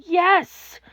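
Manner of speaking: scream. A narrator's reading. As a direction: shout it out in the story. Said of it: "He wanted to scream".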